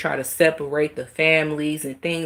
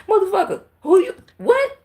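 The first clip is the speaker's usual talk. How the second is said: high, loud outraged cry; furious shout; 4 dB louder than usual. Real, not acted. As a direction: blow up in disbelief. Outraged rant at CPS.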